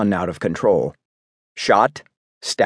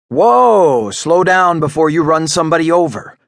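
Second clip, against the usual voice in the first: firm call to stop; 6 semitones higher, 5 dB louder than usual. Acted. Doctor stops man barreling inside.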